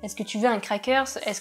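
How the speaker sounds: put-on French said with a light American accent; mock American accent. Host girl mimics her host mother's American-accented French. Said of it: "Avec mon sublime accent, vous pouvez deviner".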